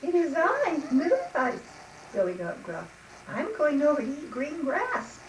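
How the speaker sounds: high, lilting goat voice; high-pitched storybook goat; middle-sized billy goat. Acted. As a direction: pipe up brightly to the troll. Middle goat answers the troll.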